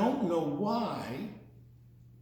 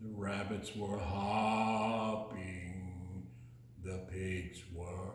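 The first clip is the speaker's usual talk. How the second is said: sinking voice; 11 semitones lower, 7 dB quieter, slower than usual. Acted. Voice drops for pigs.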